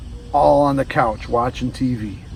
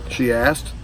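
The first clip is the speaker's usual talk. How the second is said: usual speaking voice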